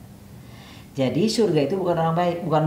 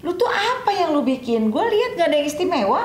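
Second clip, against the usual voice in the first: much higher, puzzled questioning voice; high voiced companion asking; 13 semitones higher than usual. (suara tinggi, bertanya heran)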